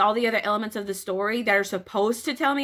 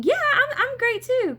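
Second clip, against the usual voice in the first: much higher, put-on chirpy voice; a bright mock reply in a different voice; second speaker in small talk; 13 semitones higher than usual. Shown as a demonstration. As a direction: play the other person, pitched way up. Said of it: "as boring as a lot of our daily engagements".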